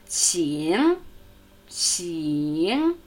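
"Qing" with a long, low-to-rising i; clear I vowel. Shown as a demonstration. Teacher letting learners hear the vowel, "Qing" with drawn-out i. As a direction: stretch the i in "Qing" through the dip. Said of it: "Vokal I".

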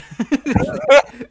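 loud high laugh; burst of laughter. Real, not acted. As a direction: crack up laughing. Laughing at friend's joke.

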